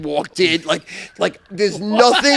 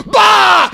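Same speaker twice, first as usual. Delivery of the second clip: sudden loud "Bah!" to scare someone; mock scare shout; 12 dB louder than usual. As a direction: jump out and yell to startle. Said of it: "I'd be like".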